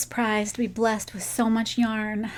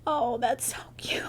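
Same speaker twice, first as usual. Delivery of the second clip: soft whisper; 7 dB quieter than usual. Real, not acted. Admiring gift on camera.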